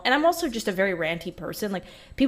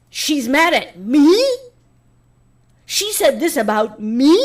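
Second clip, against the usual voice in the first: high, loud, drawn-out mock indignation; mocking whiny outrage; 7 semitones higher, 10 dB louder, slower than usual. YouTubers reacting to her clip, mock whiny outrage. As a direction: whine it like an offended YouTuber. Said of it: "a lot of them were like".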